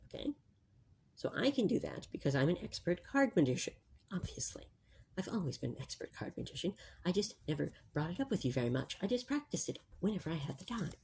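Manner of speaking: hushed library voice, barely above a breath; library whisper. Real, not acted. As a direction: keep it soft so nobody in the library is disturbed. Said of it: "we always use our library voices in the library".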